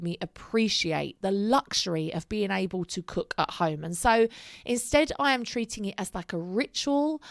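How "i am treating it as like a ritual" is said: ordinary talk, nothing marked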